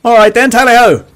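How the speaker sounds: hammed-up British accent; mock British accent. Shown as a demonstration. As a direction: exclaim like a cartoon Englishman. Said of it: "What about British?".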